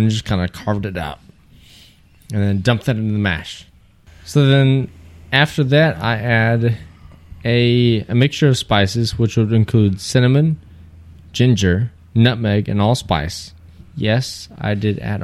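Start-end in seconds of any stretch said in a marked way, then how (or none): none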